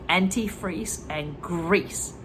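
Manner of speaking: ordinary delivery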